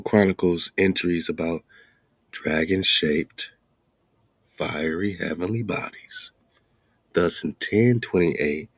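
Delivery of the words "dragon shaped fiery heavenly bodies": ordinary talk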